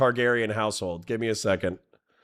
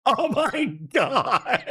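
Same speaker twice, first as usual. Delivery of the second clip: high, slow words dissolving into laughter; helpless laughing; 12 semitones higher, slower than usual. Real, not acted. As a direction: gasp it out through a laughing fit. Overcome by laughter at image.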